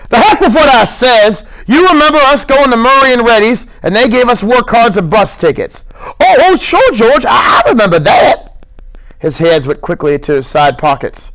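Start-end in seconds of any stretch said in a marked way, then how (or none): 0.1-5.8 loud, exasperated snap; sharp raised retort; irritated George. Acted. George snapping at Lenny. 6.2-8.6 very high, eager stammer; smiling excited high voice; delighted Lenny. Acted.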